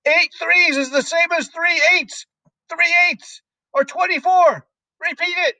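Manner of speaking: very high, loud drilling; furious shout. A narrator's reading. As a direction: bellow the sums at a terrified pupil. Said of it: "the Trunchbull shouted".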